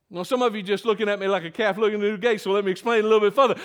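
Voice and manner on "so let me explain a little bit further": ordinary talk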